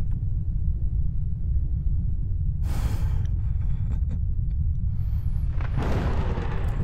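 drawn-out menacing villain cackle; long evil laugh. Acted. Demon hears the invasion outside, long evil cackle. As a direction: cackle on and on, relishing the chaos.

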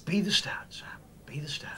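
hushed, mystical whisper; whispered urging. Pretend. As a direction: whisper encouragement as if coaching someone into a trance. Hushed coaching of guest while guessing.

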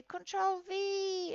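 high, slow chanted class answer; mock pupils chanting back; children answering. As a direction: sing it out like pupils in chorus. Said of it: "And they will say".

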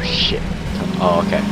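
usual speaking voice

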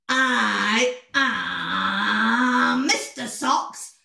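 hugely drawn-out self-introduction; Mister Socks character. Acted. Mister Socks introducing himself in his dance, grandly drawn out. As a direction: stretch the opening words very slowly.

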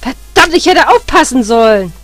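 loud frustrated cursing at oneself; angry self-reproach. Real, not acted. Gamer blames himself after dying, loud and angry. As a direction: curse your own carelessness.

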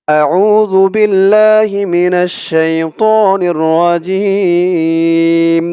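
slow, chanted tajweed recitation; drawn-out Quranic chant. Acted. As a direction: recite it slowly in melodic tajweed.

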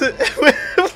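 real high laugh bursting out; can't hold back a squeaky laugh; sudden high-pitched laugh; high burst of laughter. Real, not acted. Reacts to being called Roblox.

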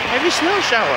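playing his earlier cocky self; lightly voiced smug confidence; mock carefree. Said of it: "I thought".

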